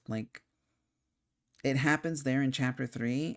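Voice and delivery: normal talking voice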